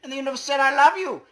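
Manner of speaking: high, loud, squeaky proclamation; squeaky universe voice. Acted. Voicing the universe to the player as a loud chipmunk.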